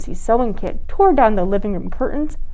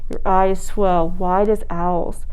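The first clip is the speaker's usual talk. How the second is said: ordinary delivery, nothing marked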